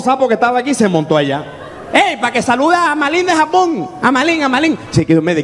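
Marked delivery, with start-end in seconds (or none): 1.9-4.8 high, insistent hail; calling out. Acted. Pushy hanger-on asking singer for greetings, high calling voice. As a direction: call across the stage for a greeting.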